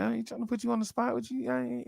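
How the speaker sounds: lightly voiced billionaire backing off; mock billionaire voice. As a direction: brush off the accusation fast. Billionaire's retold denial.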